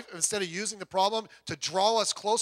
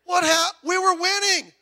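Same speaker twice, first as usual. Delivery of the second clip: put-on loud, much higher whining baby; little baby; 12 semitones higher, 10 dB louder than usual. Player stunned by the interception, whining like a baby. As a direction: whine like a crying baby. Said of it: "Like a little baby".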